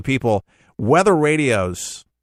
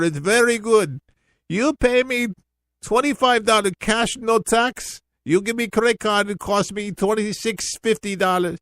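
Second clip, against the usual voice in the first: much higher imitated Indian accent, salesman pitch; Indian accent imitation; 10 semitones higher than usual. Host retells the Indian shop clerk selling Evian, imitated Indian accent. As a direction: imitate the Indian shopkeeper pushing premium water. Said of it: "the guy had an Indian accent".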